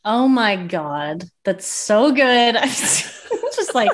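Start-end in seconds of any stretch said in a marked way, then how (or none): 1.4-3.9 slow, laughing through it; laughing with glee; delighted laughter. Real, not acted. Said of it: "howling at the moon".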